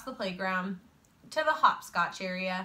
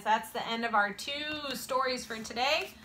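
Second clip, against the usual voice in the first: plain reading voice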